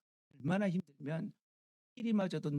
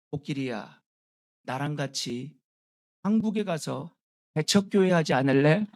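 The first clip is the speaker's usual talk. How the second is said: pastor coaxing the elephant; 8 dB louder than usual. Acted. Pastor asks the elephant to join him, building to a loud punchline.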